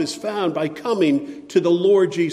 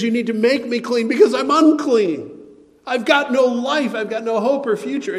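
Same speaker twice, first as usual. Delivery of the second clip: desperate plea; 8 semitones higher, 6 dB louder than usual. Acted. Leper voiced in desperate plea.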